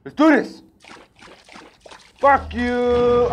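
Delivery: high, loud battle shout; loud hype yell. Real, not acted. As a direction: shout to psych yourself up. Confronting the enemy in game.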